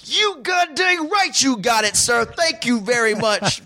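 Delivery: elated shout. Retold or imitated. Homeowner thanking the city worker, elated high shout. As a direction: shout thanks in pure relief.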